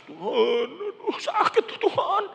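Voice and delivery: very high, drawn-out wailing prayer; hammed-up tearful whimper; mock crying. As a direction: imitate someone sobbing to God in pain. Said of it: "Sampai nangis-nangis".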